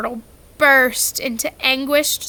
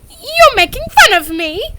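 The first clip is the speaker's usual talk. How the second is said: high, loud hurt accusation; on the edge of tears; hurt Myrtle; 12 semitones higher, 9 dB louder than usual. A narrator's reading. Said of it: "tears welling".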